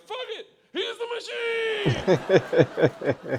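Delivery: very high, loud, drawn-out scream; rowdy group cheer. Acted. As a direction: holler it like a drunken gang cheering. Gangsters all cheer his name.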